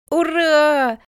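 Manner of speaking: put-on high, loud child's hurrah; joyful shout. A narrator's reading. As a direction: cheer like a thrilled little boy. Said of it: "закричал тот".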